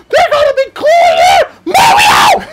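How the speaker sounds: very high, loud tour-guide shout; shrieking guide voice. Pretend. Dubbing the guide character for Mario, high and loud. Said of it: "It hurts in my ears every time you talk like that".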